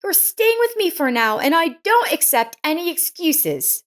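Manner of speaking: put-on high, firm young woman's voice, decision made; emphatic neighbor Lisa. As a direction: lay down the law kindly, no arguments. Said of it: "Lisa said emphatically".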